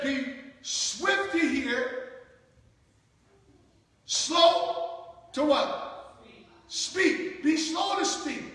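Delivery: drawn out call and response; slow it down and let them answer; slow teaching recitation; slow emphasis. Shown as a demonstration. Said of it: "So notice what he said".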